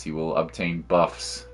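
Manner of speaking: ordinary talk, nothing marked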